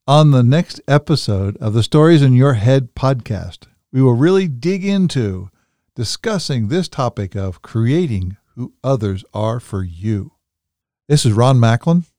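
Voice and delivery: lifted, projected host sign-off; podcast outro. Real, not acted. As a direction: announce the next episode with lively emphasis.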